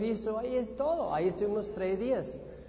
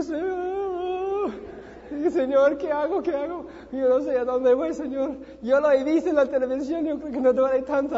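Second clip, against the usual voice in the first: high, loud, tearful crying out to God; frantic prayer before jumping; desperate plea; 7 semitones higher, 7 dB louder than usual. Retold or imitated. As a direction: beg the Lord in panic.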